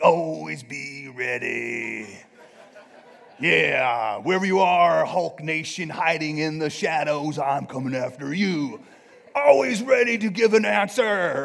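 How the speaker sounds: loud, drawn-out wrestler promo voice; Macho Man. Acted. Preacher mocking macho verse readers, booming wrestler voice. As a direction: bellow it slowly like a hammed-up wrestling champion. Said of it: "it's Macho Man saying".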